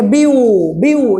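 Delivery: बीयू sounded out alone with a held "oo"; isolated syllable demo. Shown as a demonstration. Teacher showing a vowel sound, बीयू held out. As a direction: hold the final vowel of बीयू. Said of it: "यहाँ पर vowel sound बन रहा है".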